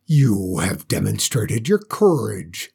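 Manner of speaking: low, scornful dragon voice; mocking dragon. Dragon scorning the girl who would rule, low and mocking. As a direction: sneer down at the little girl.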